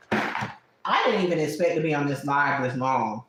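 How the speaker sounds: ordinary delivery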